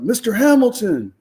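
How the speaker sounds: high, loud, slow sing-song call of the name; drawn-out high mock cop call. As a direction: taunt him like a cop who caught him. Cops calling his name, catching him.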